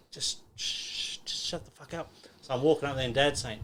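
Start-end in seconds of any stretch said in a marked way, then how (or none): 0.1-2.1 hushed, irritated shush; hushing. Retold or imitated. Older boys quietly hushing a cocky young boxer, hushed. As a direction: imitate them shushing a cocky kid under their breath.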